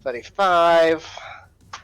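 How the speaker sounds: usual speaking voice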